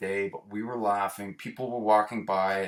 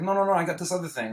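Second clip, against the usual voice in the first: quick, higher mock dealer brushing them off; pushy dealer; 8 semitones higher, faster than usual. Dealer steering the boys to another drug, quick and pushy. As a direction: hurry him along, lightly acted.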